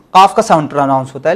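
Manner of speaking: normal talking voice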